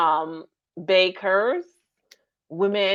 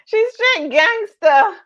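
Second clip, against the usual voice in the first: high squealing laugh through words; 9 dB louder, much slower than usual. Real, not acted. Host laughing at praise.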